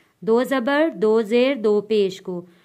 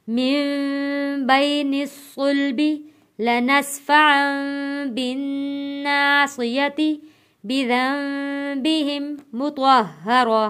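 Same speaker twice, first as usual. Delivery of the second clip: slow recitation; 3 semitones higher, much slower than usual. Shown as a demonstration. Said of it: "teacher reads the verse fluently".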